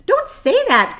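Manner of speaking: high, loud pained groan; groaning plea. A narrator's reading. Disgusted pig groaning at the spider. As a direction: beg her to stop. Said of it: "groaned Wilbur".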